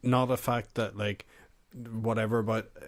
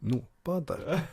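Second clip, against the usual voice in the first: slow, higher mock South African accent; attempted South African accent; 5 semitones higher, slower than usual. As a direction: echo it in a badly faked South African accent. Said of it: "what does South African accent sound like again?".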